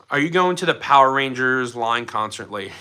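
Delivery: ordinary talk, nothing marked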